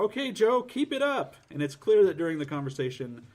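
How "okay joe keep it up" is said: perky female voice. Retold or imitated. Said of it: "she tells Joe".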